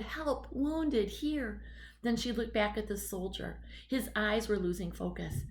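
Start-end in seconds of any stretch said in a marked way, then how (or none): 0.0-1.6 high, urgent calls for help; desperate high cries for help; calling for help. Acted. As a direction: shout it out for anyone to hear. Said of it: "She called out as loud as she could".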